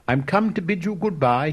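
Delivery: cried out. A narrator's reading. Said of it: "he cried".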